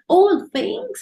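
high, scornful All things?; angry retort. Retold or imitated. Grieving woman scoffs at a comforting verse, angry and high. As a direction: spit the phrase back in angry disbelief. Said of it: "so angry".

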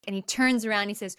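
ordinary delivery

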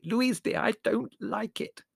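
high, slow, nervous plea; shaky whisper. A narrator's reading. Prue appealing to Louise at the séance, shakily. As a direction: plead anxiously in a high, small voice. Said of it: "whispered Prue shakily".

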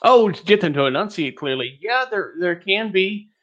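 normal talking voice

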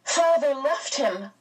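high, distressed outcry; crying out. A narrator's reading. Meg accusing father over the little boy, crying out. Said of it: "Meg cried".

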